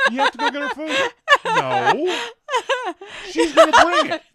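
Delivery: high, loud, laughing disbelief; hammed-up outraged diner, cracking up; incredulous protest. Husband retelling argument over who gets food, comic incredulity.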